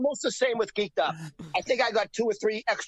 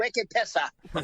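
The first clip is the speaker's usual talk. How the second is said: put-on slow, thick Boston accent wicked pisser; much slower than usual. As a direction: drawl it like a Boston local. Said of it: "I'm doing my Boston accent".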